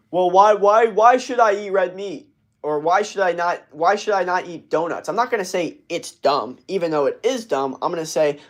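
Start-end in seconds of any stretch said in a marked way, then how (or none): 0.1-5.1 higher, put-on voice of a sceptical guy asking why; doubting guy. Streamer voicing an imagined guy challenging him, pitched-up whiny questioning. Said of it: "Imagine if some guy goes".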